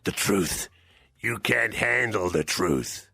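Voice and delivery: low, slow movie-star impression; deep, deliberate film-actor imitation; Jack Nicholson. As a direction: drop low and drag out the courtroom line. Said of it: "Jack Nicholson".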